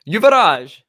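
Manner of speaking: Yuvaraj called out high and loud; exuberant shout. Pretend. Co-host playfully hollering a viewer's name, exuberant shout. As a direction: belt out the name, swooping up and down.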